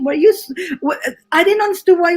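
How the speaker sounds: normal talking voice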